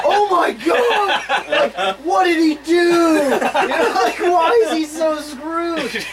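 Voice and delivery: high, slow, laughing astonishment; shocked disbelief. Retold or imitated. Retelling the movie to podcast friends, high laughing shock. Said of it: "And I was like".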